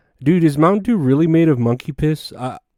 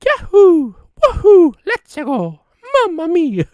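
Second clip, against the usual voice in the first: very high, exuberant video-game plumber whoops; Mario; 7 dB louder, slower than usual. Acted. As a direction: squeal it out in a high cartoon voice. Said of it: "I can do a good Mario impression".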